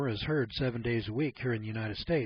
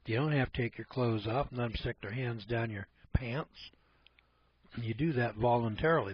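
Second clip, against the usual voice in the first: ordinary delivery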